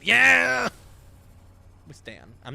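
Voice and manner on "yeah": loud, high whoop of triumph; excited shout. Real, not acted. Gamer whoops as the squid finally appears, loud and high. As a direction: yell it out like you just won. Cheering at squid jump scare.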